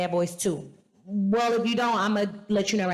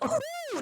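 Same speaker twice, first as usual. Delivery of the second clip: impressed ooh; 5 dB quieter than usual. Real, not acted. Reacting to the boy's glow up.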